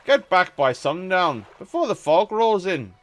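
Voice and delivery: lightly voiced town mayor giving warnings. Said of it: "I'm gonna stick with it".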